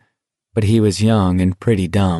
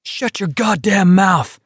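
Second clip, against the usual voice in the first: much higher, slow furious yell; furious outburst; 10 semitones higher, slower than usual. Acted. Garrity yelling at Stebbins after the insult, furious. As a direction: spit it out in rage.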